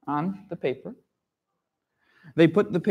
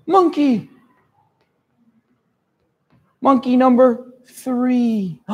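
reading the lot; 6 semitones higher than usual. Acted. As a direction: call out the name like a grim draw. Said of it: "so if it says".

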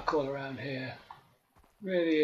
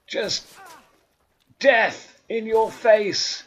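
slow loud menacing taunt; 8 semitones higher, 9 dB louder, much slower than usual. Acted. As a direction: drag out the threat. Combat taunt.

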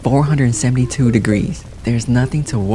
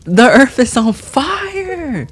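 dramatic alarm; 12 semitones higher, 3 dB louder, much slower than usual. Real, not acted. Earth burning in game.

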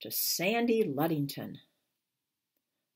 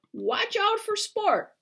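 high, slow call across the deck; 5 dB louder than usual. Acted. Crew calling a warning about the puppy, high and slow. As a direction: warn the crew, drawn out and high.

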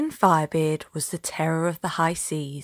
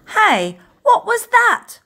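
a loud yell, sharply higher at the end; startled yell; 7 dB louder, slower than usual. Acted. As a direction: yell out at the sudden splash. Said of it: "Morgan O'Meany yelled".

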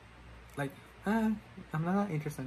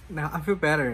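louder relieved; 9 dB louder than usual. Real, not acted. Relief after makeup.

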